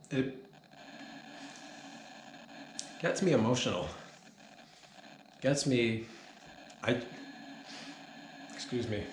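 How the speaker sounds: pretending to choke up, voice catching a little; a hammed-up emotional pause; mock choked up. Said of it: "I get choked up every time".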